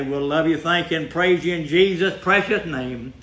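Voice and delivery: ordinary talk, nothing marked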